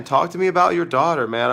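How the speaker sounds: normal talking voice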